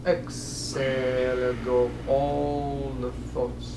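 slow, measured meditation cues with long-drawn words; guided breathing meditation. Acted. Teacher guiding breath into the mind, slow meditation voice. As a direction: lead a slow breath in and out, leaving pauses.

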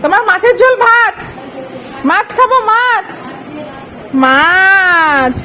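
put-on high, loud sing-song baby talk. Mother asking the baby what she eats, high baby talk. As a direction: chirp at the baby over her watermelon.

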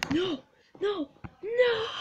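high cries building to a loud yell; hammed-up wail of defeat; mock protest. Mock protest of defending player.

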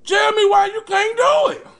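shouting the challenge; loud. Real, not acted. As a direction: call it out loud. Pushes audience to answer.

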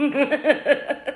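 high, loud laughing squeal; laughing ooh. Real, not acted. Laughing at own joke.

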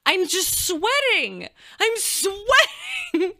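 high, drawn-out comic wail; slow, high-pitched mortified squeal; squealed lament. Real, not acted. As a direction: stretch it into a laughing whine. Wailing about her embarrassing state.

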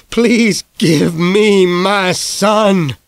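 very slow, high, pleading; slow begging. A narrator's reading. Father begging the dying doctor for his son, slow and high. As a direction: draw out the plea like a last hope. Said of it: "Dad begged".

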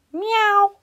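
high meow; cat meow. A narrator's reading. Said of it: "said the cat".

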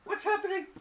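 high, loud panicked screaming under attack; shrieking in terror, pleading don't. Real, not acted. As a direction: scream in real panic as the wolf hits. Wolf attacks the player.

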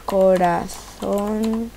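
very slow, stretched "Corazón"; slow self-dictation; typing aloud. Real, not acted. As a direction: draw out each syllable as you type. Types name while saying it.

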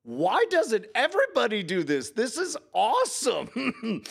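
mock the kid's thrilled yell, laughing on the last words; a teen's thrilled half-laughing yell, imitated; retold excited scream. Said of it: "And she screamed".

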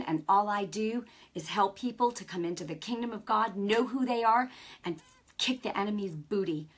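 ordinary talk

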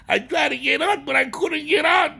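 rising frustrated shout. Acted. Trapped in the dream.

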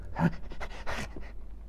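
voiceless, choking creature rasp; stuttering throaty gasps; creature throat noise. A narrator's reading. Gollum before he answers Frodo, throaty choking noise.

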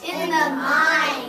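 loud, slow choral "a picture"; class echo. Real, not acted. Students echoing the teacher, slow and loud. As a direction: shout it back together. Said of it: "Repeat after me".

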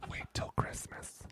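soft, slow, breathy mom reply; whispered mom voice. Retold or imitated.

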